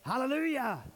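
crying out. Retold or imitated. Preacher voicing the great multitude in heaven, crying out. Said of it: "they're crying out".

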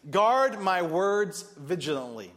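slow, deliberate sermon point; slow emphasis. Acted. Preacher stating a sermon point, slow deliberate. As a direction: lay down the point slowly.

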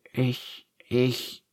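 hesitant stammered start; stammering. A narrator's reading. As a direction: stumble over the first word, unsure. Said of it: "stammelt Ferdinand".

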